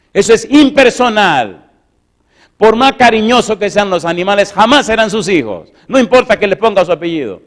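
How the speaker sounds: loud, high impassioned preaching; preaching at full force. Acted. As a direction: preach it out with fire.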